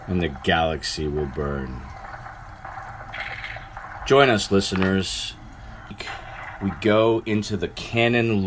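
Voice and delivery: measured, portentous trailer read; deliberate announcer delivery; podcast intro narration. Acted. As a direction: read it like a solemn prologue with pauses between phrases.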